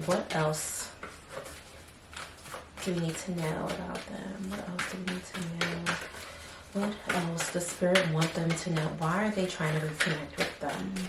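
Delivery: soft, half-voiced musing to herself; quiet muttering. Real, not acted. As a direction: mutter the questions under breath. Muttered questions to Spirit.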